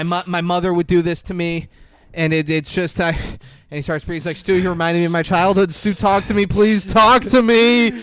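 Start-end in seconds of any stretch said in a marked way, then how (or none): 0.0-3.1 louder, higher-pitched acted unravelling; mock breakdown. Caller given the silent treatment, acting out a mental breakdown. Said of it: "like, having a mental breakdown". 4.4-7.9 high, very loud hammed-up pleading; mock breakdown. Jack Bauer begging silent Stu to talk, in a mock breakdown. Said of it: "having a mental breakdown".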